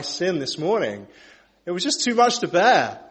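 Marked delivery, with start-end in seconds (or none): 1.7-3.0 loud, raised, overwrought confession; dramatic outburst, higher and louder; melodramatic. Real, not acted. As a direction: overplay the burden a little. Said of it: "I'm just being melodramatic".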